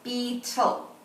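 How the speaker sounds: "Beetle" pulled apart into Bee, tle; "Beetle" with both syllables held and separate. Shown as a demonstration. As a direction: count out "Beetle" in two even beats. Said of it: "name the insect and count the syllables".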